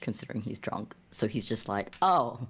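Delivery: usual speaking voice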